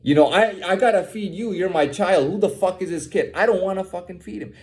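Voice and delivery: normal talking voice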